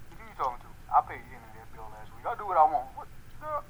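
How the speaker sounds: low, lazy dubbed voice for the animal; deep dubbed pet voice. Acted. Dubs a pet's voice.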